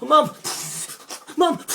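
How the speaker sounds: very loud, high scream for help; a child screaming while choking on spray; panicked cry. Acted. Boy dragged through water by dolphin, acted panic.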